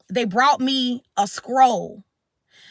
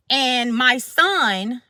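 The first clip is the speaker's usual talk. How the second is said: plain reading voice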